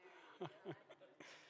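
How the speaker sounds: soft airy chuckle; laughing. Real, not acted. Preacher chuckling while taking back the insult. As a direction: let a small laugh slip.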